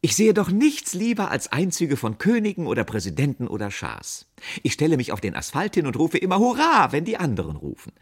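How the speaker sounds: high, loud and exuberant; bubbling over, rising to a cheer; gushing enthusiasm. A narrator's reading. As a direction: gush like a thrilled parade fan. Fenzi gushes about parades, cheering.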